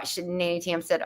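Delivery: usual speaking voice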